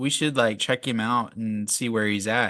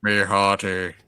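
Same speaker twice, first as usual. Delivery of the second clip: deadpan pirate cry; 2 semitones lower, slower than usual. Retold or imitated. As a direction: say it dry and flat, like a bored pirate. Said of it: "The pirate cried out".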